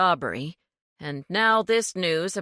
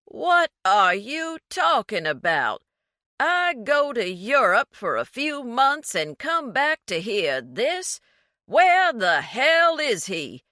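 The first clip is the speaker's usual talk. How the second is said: louder, higher angry shout; shouting into the phone; 6 semitones higher, 5 dB louder than usual. A narrator's reading. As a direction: blast it down the phone line. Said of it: "as he shouted into the phone".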